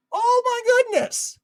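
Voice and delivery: loud, high, drawn-out exclamation; hammed-up shock, stretched and high; mock outrage. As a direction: exaggerate a scandalized outcry. Imagines media outcry over Trump.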